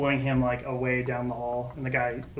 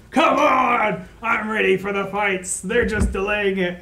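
loud, raised-pitch heckle; impatient shout; 6 semitones higher, 8 dB louder, slower than usual. Acted. Game master voicing a restless spectator before the fights, impatient shouting. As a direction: yell it out like an impatient fight fan.

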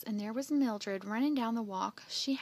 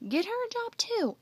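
much higher, louder, insistent; urgent persuading; 13 semitones higher, 6 dB louder than usual. Acted. Jeff talking Andy into taking Sookie, urgently persuading. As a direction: push the idea eagerly, pitch leaping.

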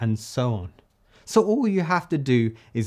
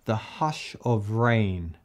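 "The hush of rain" slow and softer; slow lyric reading; 4 semitones lower, 5 dB quieter, slower than usual. Shown as a demonstration. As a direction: ease the line out softly. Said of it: "So I'm gonna say".

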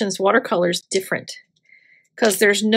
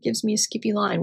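playful whiny complaint, quick; mock whine; 4 semitones higher, faster than usual. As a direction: whine about the paper, half joking. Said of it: "we're like".

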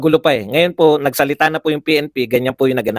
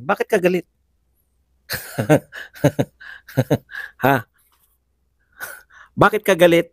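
ordinary talk

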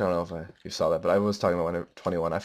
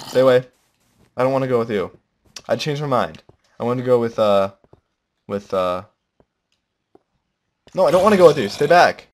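higher, louder pleading to back off; panicky warning; 5 semitones higher, 9 dB louder than usual. Acted. Player warning a game creature away, playful panic.

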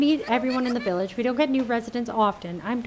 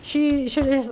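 ordinary talk, nothing marked

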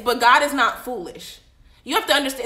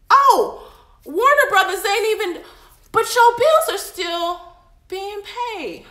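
voicing God; 7 semitones higher, slower than usual. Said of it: "How did that happen".

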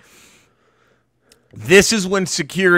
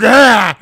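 short, loud, higher imitated roar; mock battle cry; enraged yell; 6 semitones higher, 7 dB louder than usual. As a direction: let out a sudden charging yell. Imitates man charging the stage.

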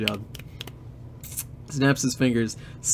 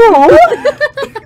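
shrieking laugh; 14 dB louder than usual. Real, not acted. Laughing at own voice.

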